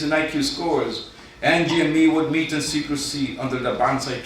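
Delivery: deadpan spoken-word poem read to a crowd; poem recital. Acted. Poet reading his contest entry to the judges, mock-solemn recital.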